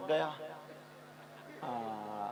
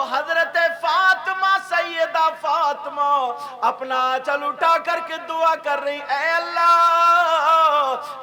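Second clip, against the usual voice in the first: fervent prayer; 10 semitones higher, 15 dB louder, slower than usual. Acted. Fatima's prayer retold.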